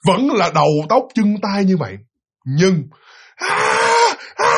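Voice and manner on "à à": short high "à" cries; acted open-vowel screams; imitated scream. Father screaming right in the child's face, imitated. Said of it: "la lên như vậy đó".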